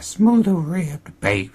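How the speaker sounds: put-on high, teasing old woman voice. Cashier teasing Noah about condoms, high and flirty. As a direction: lean in and purr it at him.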